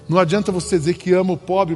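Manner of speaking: ordinary talk, nothing marked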